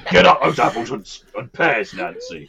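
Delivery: hammed-up villain growling the line through a laugh; Bill Sikes menace. Reworking the quote as Oliver's villain, mock threatening. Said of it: "that's more, uh, Bill Sikes in, uh, Oliver".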